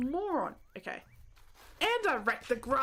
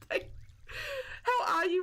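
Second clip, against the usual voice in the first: laughing greeting; 13 semitones higher, much slower than usual. Real, not acted.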